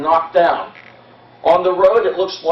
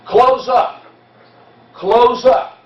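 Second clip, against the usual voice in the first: higher, louder command; officer's call; 6 semitones higher, 4 dB louder, much slower than usual. Retold or imitated. Guide acts out the officers' order to the lines, loud call. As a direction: shout it like an officer closing ranks.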